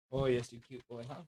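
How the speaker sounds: soft, low cooing to a pet. Real, not acted. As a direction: coo at the dog gently. Cooing to the dog.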